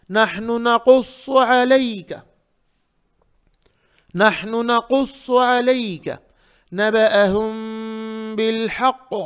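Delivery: slow, measured Quran recitation with held nasals; tajweed recitation. Shown as a demonstration. Teacher recites the verse for students, measured tajweed. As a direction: recite for students to repeat, every letter full and distinct.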